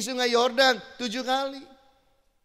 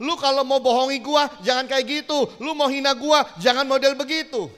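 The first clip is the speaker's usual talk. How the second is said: louder, hot-tempered street talk; indignant Naaman; 4 semitones higher, 7 dB louder than usual. Acted. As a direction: tell him off as if insulted. Naaman fuming at the prophet.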